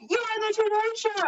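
high excited call; a burst of high reveal; excited announcement. Retold or imitated. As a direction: friends at the door shouting the reveal, excited. Friends announce surprise TV appearance.

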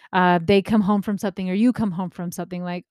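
ordinary talk, nothing marked

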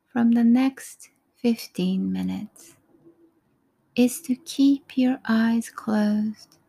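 normal talking voice

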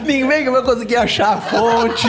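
slow, laughing mock wisdom; laughing mock proverb; joking proverb voice. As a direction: hand down fake wisdom, giggling. Joking fake Chinese proverb.

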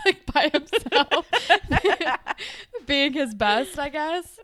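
laughing through words. Real, not acted. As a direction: giggle while saying it. Laughing at Spinner.

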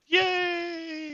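high squeal of joy; excited cheer. Real, not acted. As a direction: cheer the lucky roll. Cheering a good die roll.

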